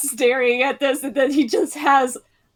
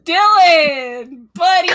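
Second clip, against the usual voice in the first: calling out; 3 semitones higher, 6 dB louder than usual. Acted. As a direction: call out long and loud to a buddy. Calling out to a character.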